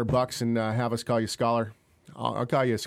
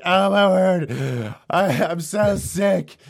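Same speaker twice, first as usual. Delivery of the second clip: slow, loud mock groaning of a sick wife; a hammed-up imitation of a sick wife moaning on the couch; 6 semitones higher, 7 dB louder, much slower than usual. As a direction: drag it out like you're dying. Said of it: "laying on the couch like".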